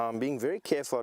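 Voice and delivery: ordinary talk, nothing marked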